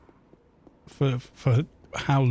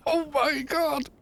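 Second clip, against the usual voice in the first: high, squeaky laughing disbelief; helpless laughter; 13 semitones higher, 3 dB quieter, slower than usual. Real, not acted. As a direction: squeal it slowly through real laughter. Cracking up at absurd prices.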